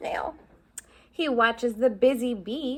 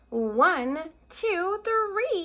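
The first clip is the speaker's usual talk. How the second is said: rising countdown; 7 semitones higher, much slower than usual. Acted. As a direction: count up, climbing high. Countdown before mouse pops out.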